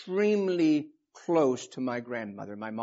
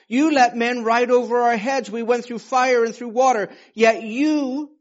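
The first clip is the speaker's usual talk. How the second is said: higher, louder and slow, pressing each line of the psalm; fervent preaching; 8 semitones higher, 9 dB louder than usual. Acted. Preacher proclaiming the psalm to his congregation, fervent and loud. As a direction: proclaim it like a rising sermon.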